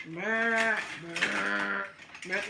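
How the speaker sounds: loud lip-rumble like a cow; cow noise. Retold or imitated. Showing viewers the cows' noise, a loud mock rumble. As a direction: rumble your lips like a cow.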